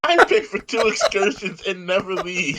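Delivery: joking to a friend about never leaving the cruise room, laughing. Real, not acted. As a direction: giggle the confession out.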